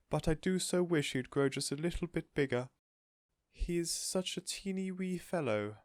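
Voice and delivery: high, cooing, slowing; doting lady. A narrator's reading. Missus Silver cooing about Alfie. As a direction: coo about tiny tortoise.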